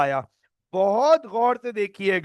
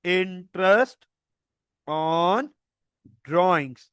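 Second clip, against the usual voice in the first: each word set apart with long pauses; teacher dictating the term word by word; slow dictation; much slower than usual. Shown as a demonstration. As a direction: spell it out slowly for students to note. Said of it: "गौर से देखिएगा".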